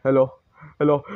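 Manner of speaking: normal talking voice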